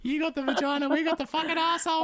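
high, put-on complaining kid voice; whiny kids. Kids complaining to their neglectful father, high and whiny. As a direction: pitch up and whine at Dad like a kid.

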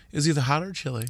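calm and quiet. Shown as a demonstration. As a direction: soften it right down. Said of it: "Let me say it again".

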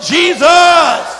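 high full shout. Real, not acted. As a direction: shout it at the devil. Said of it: "torment the devil".

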